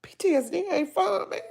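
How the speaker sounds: high voice breaking into a cry; words catching in her throat. Real, not acted. To her viewers, about her PTSD, crying.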